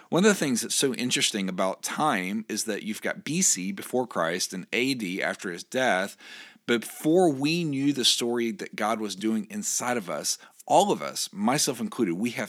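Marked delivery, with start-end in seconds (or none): none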